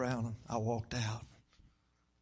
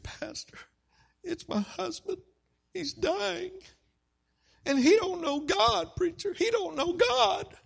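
high, slow imitated wail, building louder; distraught weeping; 5 dB louder, slower than usual. Wife telling pastor her husband is dying, distraught. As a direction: plead as a weeping wife, rising from tearful to desperate. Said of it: "She's crying. She's weeping".

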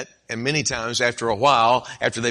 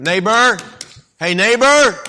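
loud, much higher call through the door; 9 semitones higher, 8 dB louder, slower than usual. Acted. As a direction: call up to the sleeping house. Calling neighbor again at night.

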